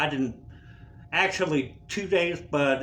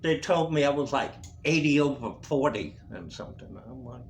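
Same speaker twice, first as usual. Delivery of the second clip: normal talking voice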